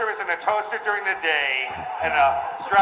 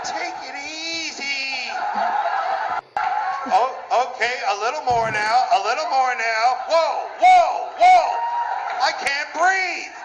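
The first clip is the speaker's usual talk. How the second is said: higher, slow breathless woman easing into it; woman in bed; 8 semitones higher, slower than usual. Acted. Comic acts out the woman in bed, higher, building to breathless cries. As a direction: play a woman overwhelmed in bed.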